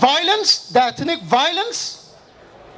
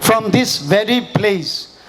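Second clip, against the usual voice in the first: ordinary talk, nothing marked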